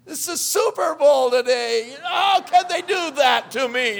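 high, loud whining protest; mock complaint. Churchgoer called away on Super Bowl Sunday, high loud mock whine. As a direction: play a put-upon churchgoer wailing about missing the game.

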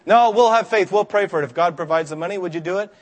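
eager youth minister pushing his idea; eager plea. Retold or imitated. Youth minister begging pastor, eager and loud. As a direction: voice jumps high and loud, pleading with faith.

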